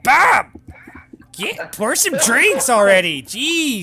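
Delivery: high, loud, slow bossy shout; impatient yell. Acted. Barmaid yells at bartender to pour drinks, loud slow high shout. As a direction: bark the order and drag it out. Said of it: "she yells".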